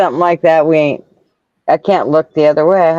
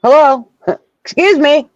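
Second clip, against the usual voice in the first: laughing mock greeting; 9 semitones higher than usual. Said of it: "I'm like".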